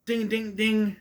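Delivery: high-pitched "ding, ding, ding" of a ring bell; imitated bell. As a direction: ring it out like the bell ending a round. Imitates the bell ending the round.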